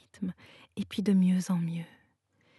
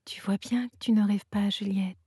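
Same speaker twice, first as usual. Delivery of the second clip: usual speaking voice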